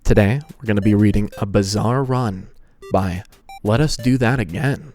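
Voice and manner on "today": ordinary delivery, nothing marked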